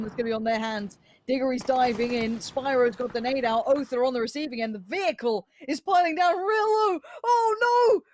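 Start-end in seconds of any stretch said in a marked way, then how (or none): 4.7-6.3 rising excitement. Real, not acted. Caster builds to a kill. 6.3-8.0 high, loud caster scream; grenade warning yell. Real, not acted. Caster shouts at vehicle kill.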